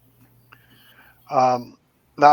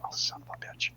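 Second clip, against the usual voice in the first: exasperated whisper; 12 dB quieter than usual. Retold or imitated. Exasperated resignation.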